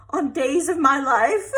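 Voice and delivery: loud, high, breaking into a laugh; amused at her own drama; laughing self-mockery. Real, not acted. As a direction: deliver the joke and crack up. Joking about her own complaining.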